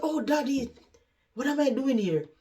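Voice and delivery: high-pitched bewildered exclamation; suddenly baffled, voice lifted high; startled realization. Retold or imitated. Daughter recognizing her father at the door, startled.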